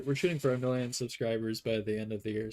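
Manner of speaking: ordinary talk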